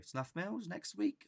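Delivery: high, drawn-out hopeful question; eager local asking. Acted. Local friend proposing a trip, high inviting question. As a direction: pop up with a sing-song invitation.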